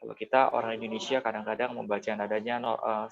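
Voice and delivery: ordinary talk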